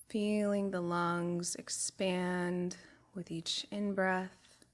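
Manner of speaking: calm body-scan voice, long pauses between phrases; guided meditation. Real, not acted. Guide taking a group through a body scan, soothing meditation voice. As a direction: guide them unhurriedly, letting each phrase settle.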